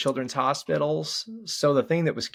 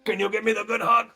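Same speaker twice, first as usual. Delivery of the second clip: asking for a hug; 10 semitones higher, 3 dB louder than usual. Real, not acted. Father asks his son for a good hug, playfully.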